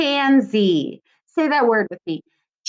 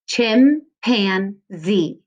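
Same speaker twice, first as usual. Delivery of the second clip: Chimpanzee stretched out very slowly, syllable by syllable; slow syllable demo; much slower than usual. Shown as a demonstration. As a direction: draw out Chimpanzee slowly for the class. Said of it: "So I'm gonna do two E's".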